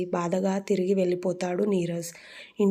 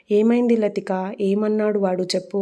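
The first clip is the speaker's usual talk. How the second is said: usual speaking voice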